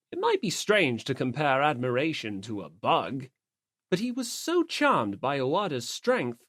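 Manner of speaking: plain reading voice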